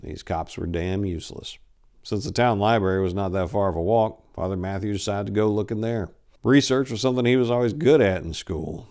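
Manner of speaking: ordinary delivery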